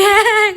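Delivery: a short, high, loud laugh; burst of laughter. Retold or imitated. The mother laughing at her daughter's joke.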